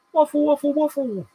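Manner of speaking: squeaky high singsong waffle. Pretend. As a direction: chant it in a tiny high voice. Mocking own waffling, very high.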